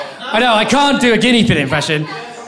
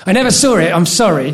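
ordinary talk, nothing marked

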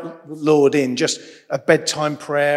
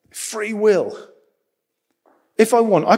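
sudden loud burst; 7 semitones higher, 5 dB louder than usual. Real, not acted. Sudden outburst for effect.

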